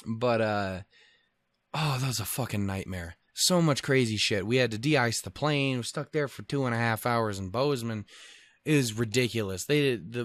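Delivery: usual speaking voice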